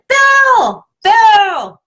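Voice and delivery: very high, squeaky cartoon mouse voice; goofy exaggerated cartoon squeak on the name; Mickey Mouse voice. Shown as a demonstration. Tapping teacher demos venting in a Mickey Mouse voice. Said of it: "do it in a Mickey Mouse voice and kinda make it funny".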